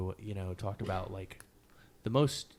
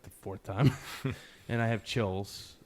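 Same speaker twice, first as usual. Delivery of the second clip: ordinary talk, nothing marked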